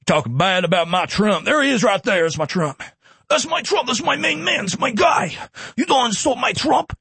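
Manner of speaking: imitating a gushing Trump supporter; mocking Trump fan. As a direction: play a starry-eyed superfan, pitch rising sharply. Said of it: "People go".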